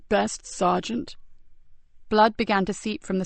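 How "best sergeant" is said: weak dying voice. Acted. Wounded Joseph weak.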